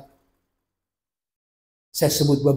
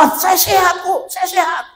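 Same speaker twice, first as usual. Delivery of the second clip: high, brushed-off protest; acted daughter's protest. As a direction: wave off the worry. Daughter and mother arguing about checkup.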